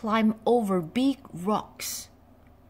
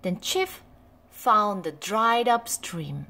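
ordinary delivery, nothing marked

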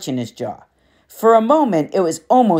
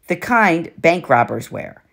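ordinary delivery, nothing marked

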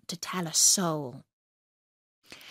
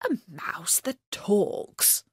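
slow, hushed amazed thought; whispered thought; 3 dB quieter, slower than usual. A narrator's reading. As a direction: wonder it in a whisper to yourself. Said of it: "thought Bertie".

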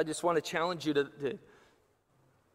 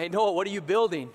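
louder, higher quizzical neighbor; curious neighbor; 5 semitones higher, 7 dB louder than usual. Acted. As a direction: call out to Noah, puzzled. Neighbor mocks Noah.

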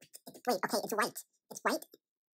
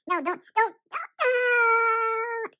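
terrified scream; 10 semitones higher, 10 dB louder, much slower than usual. Acted. The lion is about to get him.

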